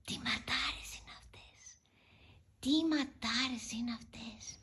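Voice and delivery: soft admiring whisper. Real, not acted. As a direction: hush it out, no voice.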